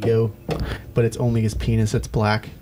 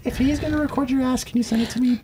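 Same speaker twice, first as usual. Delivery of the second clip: put-on high girly voice, quoting her; a much higher voice for her words; imitating a girl; 14 semitones higher, faster than usual. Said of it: "She goes".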